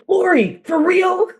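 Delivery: high-pitched mock-incredulous husband; put-on high voice, questioning; imitating woken husband. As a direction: squeal it as the husband's disbelieving complaint. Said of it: "when he wakes up and he'll go".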